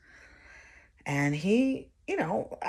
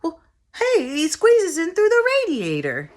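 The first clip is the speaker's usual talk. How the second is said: put-on high, loud four-year-old voice; eager kid blurting out an explanation; little boy covering; 10 semitones higher, 7 dB louder than usual. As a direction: pipe up loudly like a small boy. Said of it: "Brian had to cover for the guy".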